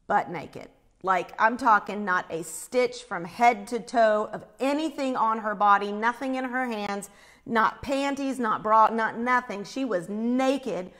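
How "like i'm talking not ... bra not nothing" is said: normal talking voice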